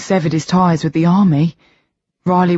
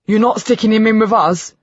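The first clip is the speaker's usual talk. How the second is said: higher, louder outburst; shout; 7 semitones higher, 3 dB louder than usual. A narrator's reading. B objecting to the doctor's plan, outraged shout. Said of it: "I shout".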